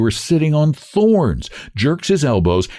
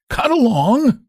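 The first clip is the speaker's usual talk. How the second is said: cracked voice; 14 semitones higher than usual. A narrator's reading. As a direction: bark it out in a cracked voice. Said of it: "cracked voice".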